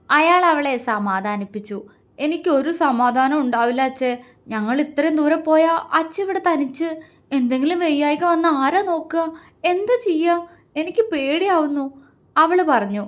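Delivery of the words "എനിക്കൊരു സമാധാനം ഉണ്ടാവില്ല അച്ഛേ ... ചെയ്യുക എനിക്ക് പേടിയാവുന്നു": higher-pitched worried daughter; anxious, upset. A narrator's reading. Daughter afraid to leave her father alone, worried and high-voiced. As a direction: fret with rising distress.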